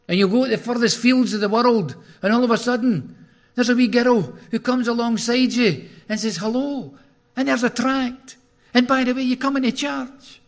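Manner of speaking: high, loud preaching at full force; fervent preaching. Acted. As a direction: drive it home to the congregation.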